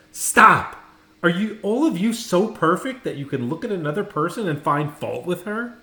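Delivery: loud, high outraged shout; stern rebuke. A narrator's reading. Teacher rebuking the laughing class, angry shout. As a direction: stop the class dead with a furious telling-off.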